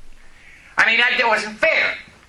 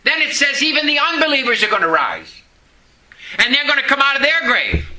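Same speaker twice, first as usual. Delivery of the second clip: usual speaking voice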